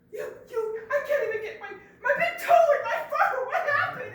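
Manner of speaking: high, loud, half-laughing complaint; upset bear yelling. Acted. Bear complaining to Monkey, loud and laughing. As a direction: rant about the tiny fur.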